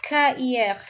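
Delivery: cahier with a silent H, ka-yay; French cahier. Shown as a demonstration. Teacher modelling cahier, silent H. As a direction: say cahier cleanly. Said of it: "So this is how we're gonna read it".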